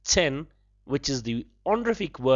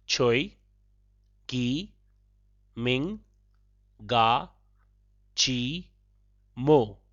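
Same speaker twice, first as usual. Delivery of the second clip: "Choi, Gi, Ming, Ga, Chi, Mo" with a pause after each syllable; broken into syllables; 2 semitones lower, much slower than usual. Shown as a demonstration. Teacher breaking up a phrase for learners, syllable by syllable. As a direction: split it into separate syllables. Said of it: "I shall break it up for you".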